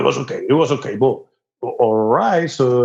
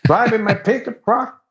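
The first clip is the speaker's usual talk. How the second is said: put-on Texas accent; 9 semitones higher than usual. Performing Texan clichés for the host, high Texas accent. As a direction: play the proud Texan, pitched well up. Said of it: "do your Texas accent".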